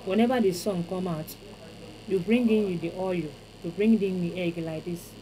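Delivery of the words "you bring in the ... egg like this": ordinary talk, nothing marked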